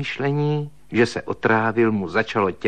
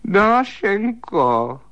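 high, very slow wailing "Dášenko"; long, high, plaintive cry of "Dášenko"; wailing call; 9 semitones higher, 4 dB louder, much slower than usual. Acted. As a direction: wail her name into the dark. Calling her again in the dark.

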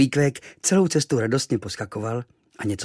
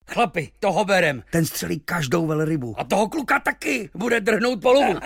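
higher excited sailors; shouting over each other; 9 semitones higher, 4 dB louder than usual. Acted. The sailors cheer to the crew, higher and excited. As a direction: cheer to take them both. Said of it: "se překřikovali".

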